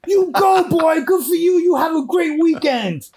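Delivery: very high, loud imitation of an excited woman; hammed-up high-pitched cheer, stretched out at the end; cheering young woman. Young woman congratulating a stranger on quitting, mimicked high and loud. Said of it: "She was like".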